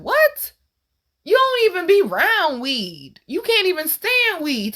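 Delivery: very high, drawn-out disbelief; incredulous. Retold or imitated. Daughter reacting to father's weed joke, incredulous. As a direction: squeal the question, then stretch the objection high.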